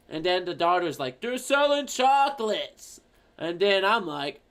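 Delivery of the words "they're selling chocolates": high, slow raised voice; exasperated yell. Acted. Daughter yelling to mom who can't hear, high slow shout. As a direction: spell it out loudly for a deaf mother. Daughter yells to deaf mother.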